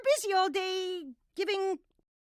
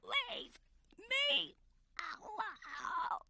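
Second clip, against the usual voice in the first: slow, strained whisper; drawn-out whispery plea; barely audible; much slower than usual. Acted. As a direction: force it out with no volume. Listener cannot hear, asks What.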